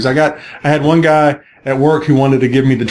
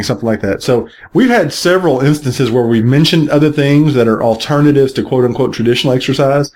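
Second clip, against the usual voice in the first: normal talking voice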